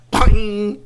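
loud bouncy poing; boing off the branch; poing sound. Acted. Marble hitting the branch, loud poing sound.

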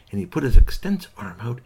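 ordinary delivery

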